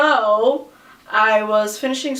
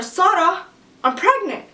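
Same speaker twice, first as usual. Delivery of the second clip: high, overwhelmed confession; retold breathless-with-news cry; re-enacted emotional announcement; 8 semitones higher than usual. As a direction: blurt out huge news, pitched way up. Reliving emotional news told to sister.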